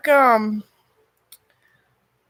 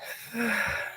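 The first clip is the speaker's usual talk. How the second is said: soft breathy sigh; 4 semitones higher, 7 dB quieter than usual. Real, not acted. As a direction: let out a quiet sigh. Pauses heavily before listing hardships.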